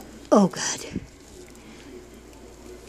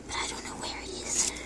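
whispering; 11 dB quieter than usual. Real, not acted. Searching quietly.

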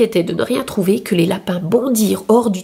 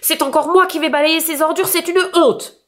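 higher, outraged complaint, falling on une; indignant Monsieur Brun; 7 semitones higher than usual. A narrator's reading. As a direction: huff about the neighbour's rubbish. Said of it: "Monsieur Brun se fâcha". (Monsieur Brun indigné qui se plaint)

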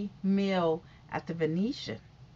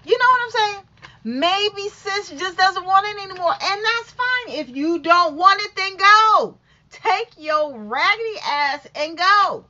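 high, loud fired-up rant; heated rant; 8 semitones higher, 8 dB louder than usual. Real, not acted. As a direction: go off, louder and higher.

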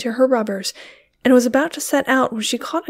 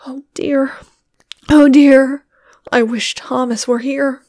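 whispered then louder frightened cry; cried; 3 semitones higher, 6 dB louder than usual. A narrator's reading. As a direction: fret aloud in fear. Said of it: "cried Sarah".

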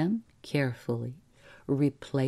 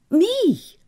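high, loud yelp of disbelief; shocked cry; 9 dB louder than usual. A narrator's reading. Clerk learning he must do the killing, shocked cry. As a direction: cry out, pitched way up. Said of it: "cried Mister Crabtree".

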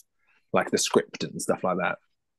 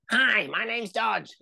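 much higher, slow, sweet little dog; cute puppy voice; 14 semitones higher, 4 dB quieter, slower than usual. Retold or imitated. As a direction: make the dog sound adorable. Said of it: "I'm gonna make him cute".

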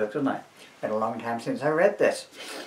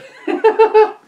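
high loud giggle; 12 dB louder than usual. Real, not acted.

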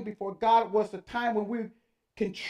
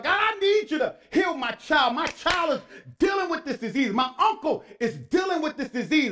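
raised, urgent cry to God; pleading prayer; 6 semitones higher, 5 dB louder than usual. Acted. Preacher as parent begging God to heal child, pleading.

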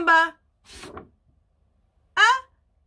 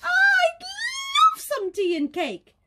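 very high, slow delighted cry; excited goose; 3 dB louder, slower than usual. Acted. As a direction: squeal it with delight. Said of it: "said Bruce the goose".

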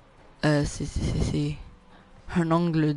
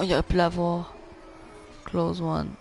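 normal talking voice

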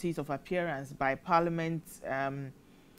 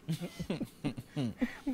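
real breathy chuckle, softer; laugh; 6 dB quieter than usual. Real, not acted. Presenter amused by a listener's party comment, chuckling.

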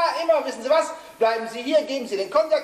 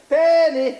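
calling out loud; 4 semitones higher, 8 dB louder than usual. Acted. Calling the fainted woman.

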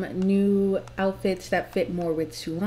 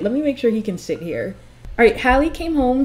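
usual speaking voice

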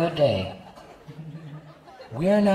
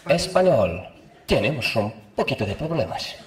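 put-on Spanish accent. Mock Spanish elevator, slow.

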